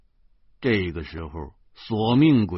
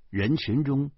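plain reading voice

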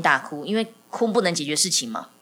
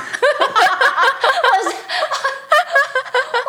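high burst of laughter; 5 dB louder than usual. Real, not acted. Laughing after toddler's lecture.

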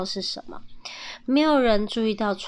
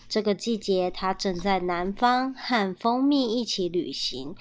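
ordinary delivery

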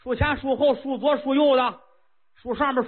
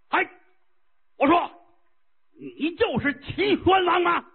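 bold giantess call; 7 semitones higher than usual. Acted. Giant woman hails the king.

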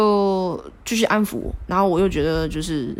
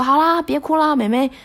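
higher, coaxing big-brother tone; coach coaxing; 4 semitones higher than usual. Retold or imitated. Coach offering a photo to the crying girl, higher coaxing. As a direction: coax a crying girl kindly. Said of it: "他就说".

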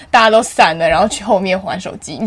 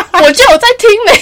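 much higher and louder, defensive snap; indignant retort; 11 semitones higher, 7 dB louder than usual. Retold or imitated. Answering the teacher who accused her, indignant retort. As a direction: fire it back defensively.

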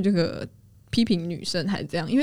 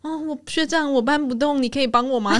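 put-on cutesy girl pleading; 7 semitones higher than usual. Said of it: "很漂亮的一个女生过来说".

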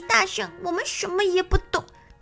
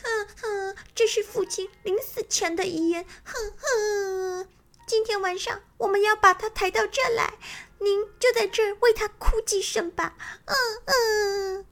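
high, loud hammed-up sobbing; fake crying; 10 semitones higher, 8 dB louder than usual. Little crocodiles fake-cry to the bird, high and sobbing. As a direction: wail like children faking grief. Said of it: "小鳄鱼们异口同声地说".

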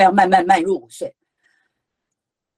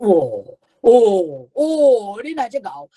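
acted amazement; much slower than usual. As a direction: marvel at a child's idea, each "oh" bigger. Acts amazed at child's ideas. (夸张惊叹，一声比一声高)

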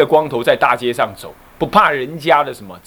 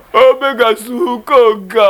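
tearful nun; 8 semitones higher, 4 dB louder than usual. Retold or imitated. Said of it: "一把鼻涕一把泪".

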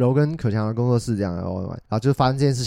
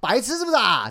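mock taunt; 9 semitones higher than usual. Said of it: "会说".

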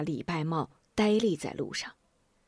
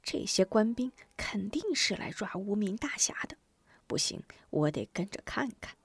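hushed, softer inner voice; private thought; 7 dB quieter than usual. Acted. Boy thinks about following the soldiers, hushed inner voice. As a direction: think it to yourself under your breath. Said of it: "他想".

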